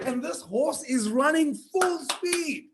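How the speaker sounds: usual speaking voice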